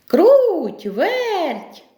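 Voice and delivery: high, drawn-out call. Acted. Rooster summons the two little mice, calling out. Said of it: "став гукати".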